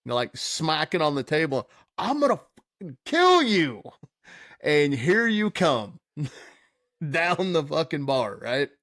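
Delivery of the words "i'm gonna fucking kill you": high, louder mock threat; mock rage. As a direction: ham up a drunk friend's fury. Quoting friend's rage at pool table.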